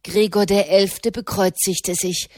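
ordinary delivery

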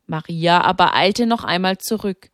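ordinary delivery, nothing marked

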